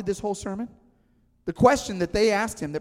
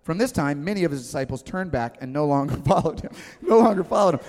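ordinary delivery

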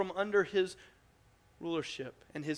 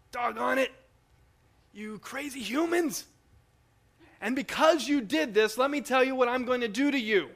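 higher, louder mock scolding; angry God; 7 semitones higher, 7 dB louder than usual. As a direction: play a hammed-up furious God as some imagine him. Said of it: "God came in and he was angry".

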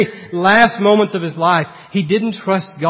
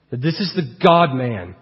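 ordinary talk, nothing marked

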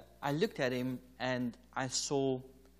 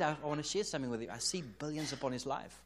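hurried insistence. Retold or imitated. Wife insisting quickly.

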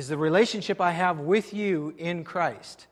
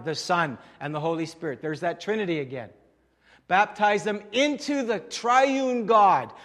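ordinary talk

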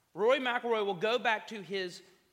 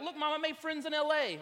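high, loud mock-childish boast; gleeful boasting; 10 semitones higher, 4 dB louder than usual. Imagined Instagram post to Mom, mock gleeful boast. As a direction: crow it proudly, hammed-up.

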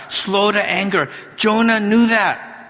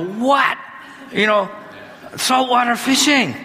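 higher-pitched mock disbelief; surprised; 6 semitones higher than usual. Husband reacting to his wife's request, mock surprised. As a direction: act shocked at the request. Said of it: "And I go".

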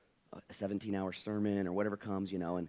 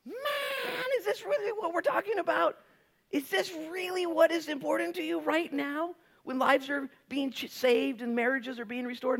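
high, loud, near-crying protest; exasperated rant; 4 dB louder than usual. Retold or imitated. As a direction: plead up high in frustration. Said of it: "I read 'em like".

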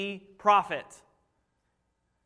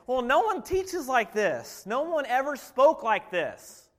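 slow, higher, amazed reply; awed guards; 6 semitones higher, 4 dB louder than usual. Acted. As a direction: speak like stunned guards. Awed guards answer priests.

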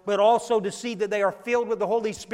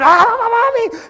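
very high, loud mimicked scream; wailing child; 14 semitones higher, 15 dB louder than usual. Child screaming while Sheila leaves church, mimicked tantrum wail. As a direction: screech like a toddler in full tantrum.

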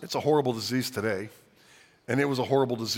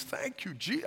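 tearful thanks; 11 semitones higher, 8 dB quieter, slower than usual. Retold or imitated. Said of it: "this guy's weeping. He's crying".

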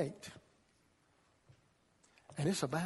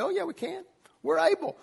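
much higher, eager yes; eager spy; 11 semitones higher than usual. Retold or imitated. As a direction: jump at the chance. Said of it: "Two spies said".

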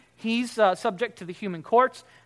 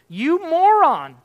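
slow, loud, higher you moron; contemptuous shout; 8 semitones higher, 11 dB louder, slower than usual. Retold or imitated. Preacher voicing a man calling his brother a moron, slow shout. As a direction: spit out the insult slowly. Quoting the harsher insult.

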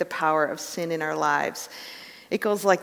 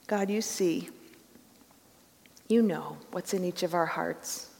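prayer; 5 dB quieter than usual. Real, not acted. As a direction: pray quietly and earnestly. Leads the congregation in prayer.

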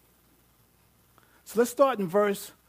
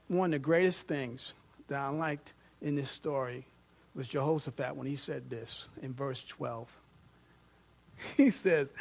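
ordinary talk

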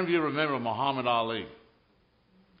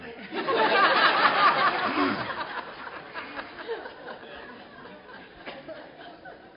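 a long, high burst of laughter; 4 dB louder than usual. Real, not acted. Preacher laughing at his own boxing joke, loud.

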